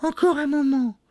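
very high, plaintive falsetto; pleading wife. A narrator's reading. Wife stalling Bluebeard, high and pleading. Wife begs for time again.

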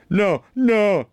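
loud, high, forceful denial; angry refusal. A narrator's reading. Bluebeard refusing his wife a moment, angry and loud. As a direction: shout down the plea.

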